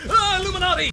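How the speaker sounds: high, loud cry of alarm; mock alarmed shout. Reading a viewer's question aloud, mock alarmed shout. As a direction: yell it in hammed-up panic.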